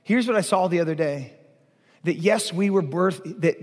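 usual speaking voice

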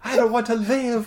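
high hammed-up wail of despair; mock despair. Quoting the film's self-pitying line, mock despair. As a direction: overplay the melodramatic whine.

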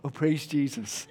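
meek, softly spoken praise; very British reserve. Retold or imitated. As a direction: praise half-heartedly, keep it small and polite. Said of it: "Very British".

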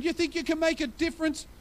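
high, scornful question; scornful sneer. Retold or imitated. Preacher voicing mockers scoffing at the church, high and scornful. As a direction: scoff at the very idea. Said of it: "They're saying".

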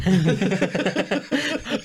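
real, high-pitched laughing fit; long laugh. Real, not acted. Joking with friend about old school days, cracking up. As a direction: crack up at your own joke.

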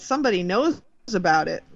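usual speaking voice

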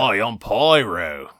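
slow, exaggerated Australian accent; cartoon Australian introducing himself; Pyro. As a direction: lay on a broad Aussie drawl. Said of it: "that's the normal accent you hear".

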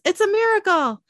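high, loud cry of a man saved; joyful yell. Acted. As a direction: yell it out in high-pitched amazement, stretching the last words. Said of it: "He yells".

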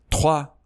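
توا said as French trois. Shown as a demonstration. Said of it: "یه ت، ت ساکنه".

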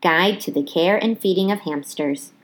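usual speaking voice

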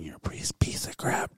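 breathy voiceless whisper of insults; the snoring voice whispering insults to him; eerie whisper. Retold or imitated. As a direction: hiss it like a haunting voice in the dark.

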